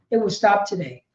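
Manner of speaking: quiet repeat. Real, not acted. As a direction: let it sink in softly. Repeated, dropped low and soft.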